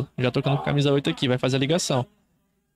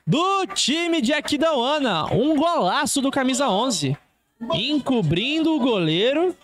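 explosive goal commentary; 10 semitones higher than usual. Real, not acted. Goal scored, commentator erupts.

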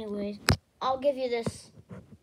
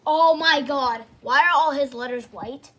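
normal talking voice

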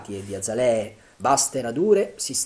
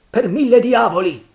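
loud, high pirate roar; roaring oath; 12 semitones higher, 12 dB louder than usual. Acted. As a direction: bellow the curse. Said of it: "ruggì Silver".

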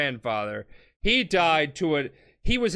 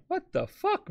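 mock shocked Jojo; 3 dB quieter than usual. Jojo quoted, shocked at taunt.

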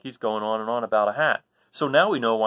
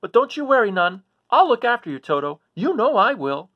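reassuring Toto; 12 semitones higher, 9 dB louder than usual. Acted. Dorothy reassuring Toto, scared.

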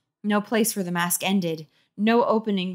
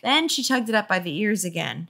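ordinary delivery

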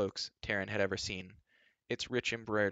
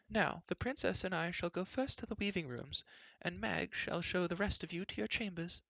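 high, bustling royal chatter; fussy queen; 9 semitones higher, 3 dB quieter than usual. A narrator's reading. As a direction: rattle on, scolding in passing. Queen gives orders.

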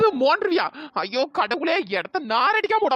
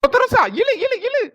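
urgent shouting; 8 semitones higher than usual. Acted. Urging friend to pull hard.

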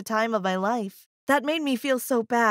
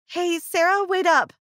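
calling out after her, higher; 7 semitones higher than usual. A narrator's reading. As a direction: call to her across the yard.

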